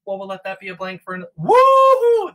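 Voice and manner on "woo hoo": very high, loud woo-hoo; unicorn cheer. Acted. Voicing the unicorn's joyful cheer. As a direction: squeal it like a giddy unicorn.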